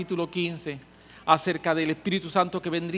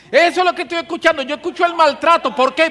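God rebuking; 9 semitones higher, 9 dB louder than usual. Acted. God's voice continues.

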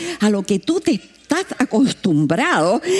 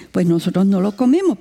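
ordinary talk

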